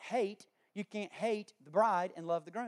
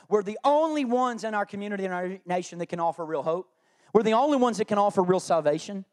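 louder, building proclamation; rally the church to rise up; rousing sermon climax; 2 semitones higher, 10 dB louder than usual. Acted. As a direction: build to a stirring call.

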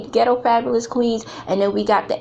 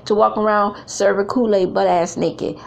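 usual speaking voice

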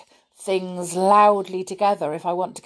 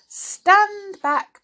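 warning call; 14 semitones higher, 4 dB louder than usual. A narrator's reading. Jack warns of fast growth.

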